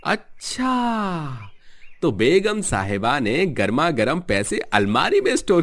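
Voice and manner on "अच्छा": sly realisation. Acted. Scheming husband spots hidden money.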